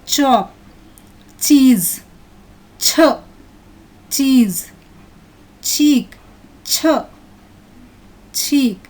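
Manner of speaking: each word alternated with a short "chuh" for "ch". Shown as a demonstration. Teacher drilling the ch sound for children, spaced-out word and sound. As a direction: say "cheese", "ch", "cheese", separately and distinctly. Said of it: "I am pronouncing C H syllable as ch".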